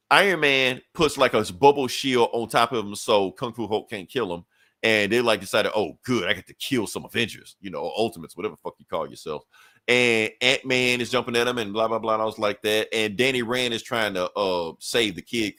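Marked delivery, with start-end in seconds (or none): 5.7-9.4 low, dropping villain gloat, a hint of menace; lightly voiced villain sneering at heroes; gloating villain quote. Comic reader voicing Kung Fu Hulk, mild sneer.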